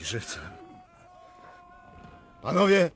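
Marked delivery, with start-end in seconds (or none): 2.4-2.9 high, loud battle cry; rally the men to charge; loud cavalry charge shout; charge order. Acted.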